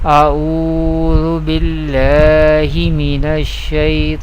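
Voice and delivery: measured, reverent recitation; Quranic recitation. Acted. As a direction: recite it like the start of a tilawah. Recites ta'awwudh and basmala.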